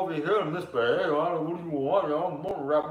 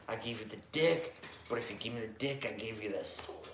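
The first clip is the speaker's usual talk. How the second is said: creepy Louisiana guy; 5 semitones lower, 3 dB quieter than usual. Acted. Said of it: "That's creepy Louisiana guy for you guys".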